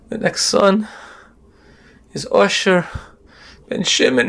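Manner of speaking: name the son as tears start; near tears. Retold or imitated. Grieving father names his next son to the Gabbai, near tears. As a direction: voice lifted, fighting tears. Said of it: "he started to cry".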